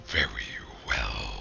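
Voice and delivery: very low, three voices as one; layered voices speaking in unison; melded monster voice. Acted. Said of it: "all three of their voices are kind of melded together".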